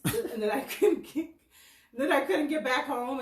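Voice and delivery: real amused laugh breaking in. Real, not acted. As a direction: laugh while recounting your own bad luck. Laughs while recounting being stranded.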